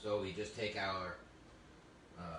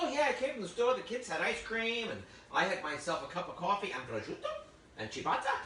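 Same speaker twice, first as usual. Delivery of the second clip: much higher, louder, gushing TV-chef parody; mocking Giada; 10 semitones higher, 4 dB louder than usual. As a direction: lampoon a chirpy celebrity chef showing off. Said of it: "That's very Giada. ... She's like".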